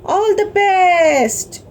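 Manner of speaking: high, drawn-out cheer for the champion; cheering crowd. A narrator's reading. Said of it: "Everybody started cheering".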